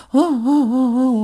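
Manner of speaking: retold mother's sobbing, a short run of broken cries; imitated sobbing. Grateful mother bowing to the teller, mock sobbing. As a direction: mimic her choked cries while bowing. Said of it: "우는 기라".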